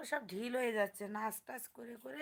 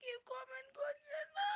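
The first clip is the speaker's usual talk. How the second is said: very high, thin wail; mock crying; 7 dB quieter than usual. Streamer laments that nobody comments, mock crying. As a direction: whine it out in a hammed-up sob. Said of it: "কেঁদেই দিলাম".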